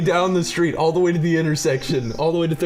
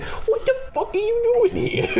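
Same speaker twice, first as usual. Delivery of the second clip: imitating a friend's much higher, awkward attempt at a tough Italian accent; awkward put-on accent. Friend mimics a buddy's bad accent in the prank, laughing. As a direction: squeak out a clumsy mobster accent through laughter. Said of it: "him trying to do an accent was just like".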